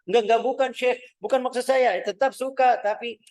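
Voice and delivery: high, loud, eager denial; flustered protest. Acted. Student hastily denying to his Syekh, high and loud. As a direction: protest fast that you still like it.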